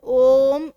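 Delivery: Om held long and loud, the vowel stretched; drawn-out Om. Shown as a demonstration. Teacher stretching ओम for students. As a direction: stretch ओम out like a chant. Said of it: "खींच के बोलो किसी चीज़".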